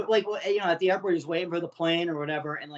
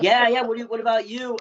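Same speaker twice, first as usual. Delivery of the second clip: higher, louder mock chatty stranger; eager questioning; 6 semitones higher, 6 dB louder than usual. Traveller asks comic where he's flying, eagerly. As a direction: toss the question back brightly, imitating a traveller. Acting out curious fellow travellers.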